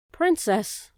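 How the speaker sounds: the mouse calls out to the princess, loud; shouted. Acted. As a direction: call across the dungeon. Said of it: "Despero shouted".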